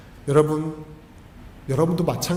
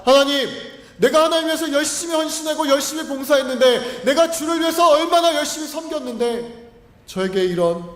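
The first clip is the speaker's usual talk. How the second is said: high, pleading complaint to God; retold prayer of resentment, pitched high and pleading; complaining to God; 7 semitones higher than usual. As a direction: cry out your grievance, much higher. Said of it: "불평과 원망이었습니다".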